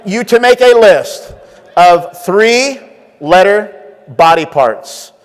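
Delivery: slow, drawn-out prompt read; slow announcement. Shown as a demonstration. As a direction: spell the condition out slowly. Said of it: "I'm gonna say it twice".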